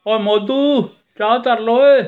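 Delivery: high, loud call across a distance. Acted. Man shouting across the field for tea, loud call. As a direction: holler for tea to be put on. Calling out from afar.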